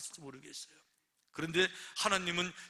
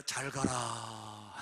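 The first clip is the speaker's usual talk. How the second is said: mocking farewell; 6 semitones lower, much slower than usual. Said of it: "나갈 때 뭐라고 말했어요".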